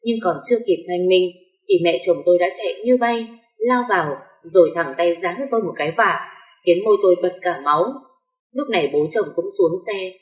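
plain reading voice

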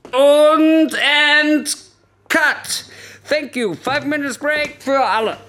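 loud, high shout to the whole set; calling cut. Acted. Director to film crew, ending the take, calling out loudly. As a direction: call it out across the studio, drawn out.

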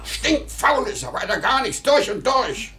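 high-pitched furious tirade; angry rant. Acted. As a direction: rant at the lazy son, voice cracking up high. Angry father rants about son.

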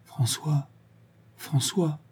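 hushed, hesitant calling; faltering murmur. A narrator's reading. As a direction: falter softly on the name, pausing between calls. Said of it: "Elle balbutia".

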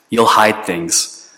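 ordinary talk, nothing marked

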